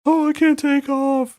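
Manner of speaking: high, slow, whiny panic; high slow mock whine. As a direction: whine it like a helpless victim. Mocking the trapped heroine.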